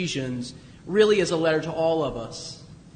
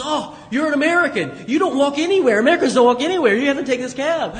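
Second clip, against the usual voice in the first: raised, louder voice of an insistent cabbie; mock-scolding cab driver trying to win a fare; pushy cab driver; 7 semitones higher, 6 dB louder, faster than usual. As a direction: imitate an exasperated cabbie, high and emphatic. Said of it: "this cab driver who was trying".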